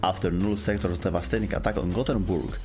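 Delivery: usual speaking voice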